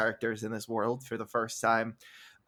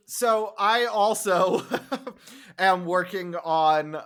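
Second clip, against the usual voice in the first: slow words breaking into laughter; laughing; 5 semitones higher, 5 dB louder, much slower than usual. Real, not acted. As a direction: laugh through it. Laughing through plug.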